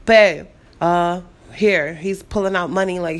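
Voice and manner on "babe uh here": imitating his sterner, firmer tone, louder; stern boyfriend. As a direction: put a little sternness into it, quoting him. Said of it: "a little more sternness and bass in his voice".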